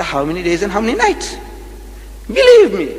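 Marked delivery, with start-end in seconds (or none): 2.3-2.9 excited exclaim. Real, not acted. Preacher exclaims to audience.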